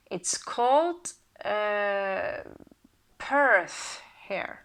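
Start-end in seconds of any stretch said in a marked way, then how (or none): 3.2-4.0 "Perth" with an English er vowel; English-style Perth. Shown as a demonstration. Reader showing how the rune is named here, English-style pronunciation. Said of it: "we don't say Perth in Norse".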